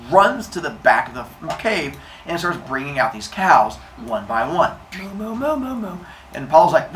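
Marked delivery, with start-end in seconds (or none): 5.0-6.0 higher playful moos; light cow impression; mooing. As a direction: moo each cow out one by one. Cows brought out one by one.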